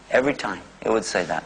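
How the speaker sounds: ordinary talk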